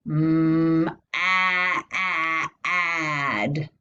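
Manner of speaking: "Mm, ah, ah, ad" sounded out in held pieces, "a" as in "cat"; short A blending. Shown as a demonstration. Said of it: "Say the word with me".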